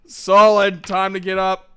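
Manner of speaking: loud call, wake him up; calling through door. Acted.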